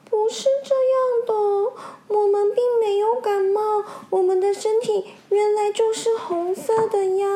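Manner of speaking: high, tearful protest; aggrieved. Acted. Red fish protesting unfairly accused, tearful high voice. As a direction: plead with a catch of tears. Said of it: "很委屈地说".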